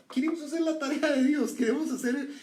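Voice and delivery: high, loud, urgent; forceful preaching. Real, not acted. Preacher rebuking the congregation, high and loud. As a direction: drive the point home to the congregation.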